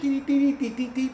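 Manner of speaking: high sing-song dee dee dee; mock flute tootling. Mimicking the kid on flute, high tootling.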